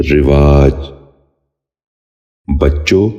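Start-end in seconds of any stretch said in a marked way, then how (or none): 0.1-0.7 رواج said "rivaaj", short "i" after the r; correct pronunciation. Shown as a demonstration. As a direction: open رواج with "ri", then long "vaaj". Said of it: "دوبارہ سنیے".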